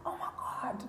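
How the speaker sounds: whispered retold gasp of disbelief; shocked whisper. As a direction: whisper it as a stunned aside. Retells her shocked reaction.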